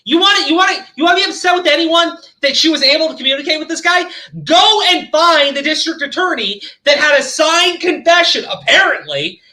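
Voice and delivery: furious rant; angry shout. Real, not acted. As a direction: yell it at the critics. Angry rant at drama channels.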